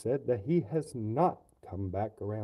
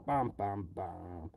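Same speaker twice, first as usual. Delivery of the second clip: ordinary delivery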